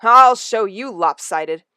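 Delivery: slow, loud mock menace; cat daring a play fight; playful threat. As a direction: growl it out with glee. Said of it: "gave a hiss of delight".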